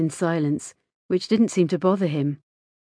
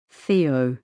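lower-pitched, subdued answer; 5 semitones lower than usual. A narrator's reading. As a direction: drop the name low. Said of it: "he said in a low voice".

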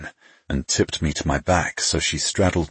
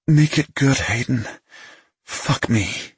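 higher, pleading female voice; breathy pleading woman; 8 semitones higher than usual. A narrator's reading. Woman begs her lover.

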